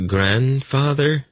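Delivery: high, slow and queasy, dreading his reaction; a high, slow, sick-sounding Grandfather. A narrator's reading. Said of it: "said in a sick tone".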